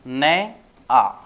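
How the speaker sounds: "ने आ" drawn out slowly as two broken sounds; wrong split pronunciation. Shown as a demonstration. Teacher shows pupils the wrong way, syllable split apart. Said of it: "अगर ऐसे बोलोगे तो ये क्या माना जाएगा".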